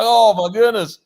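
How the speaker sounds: high exclamation of disbelief; retold amazement. Patient reacting to the doctors' story, amazed.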